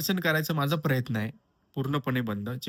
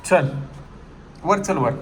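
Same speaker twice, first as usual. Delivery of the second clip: loud, high call to a dog; 3 semitones higher, 6 dB louder than usual. Real, not acted. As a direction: call the dog up the stairs firmly. Calling dog upstairs. (कुत्र्याला मोठ्याने हाक)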